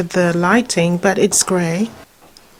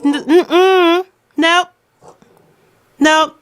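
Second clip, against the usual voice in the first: playful refusal; 9 semitones higher, slower than usual. Real, not acted. Owner teasing her dog with a playful refusal. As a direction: deny the dog cheerfully.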